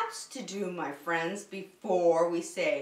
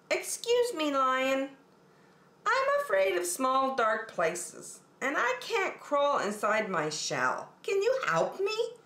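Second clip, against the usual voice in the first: much higher, pleading small-turtle voice; timid little turtle; 6 semitones higher than usual. Acted. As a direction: ask for help like a shy scared turtle. Franklin asks again.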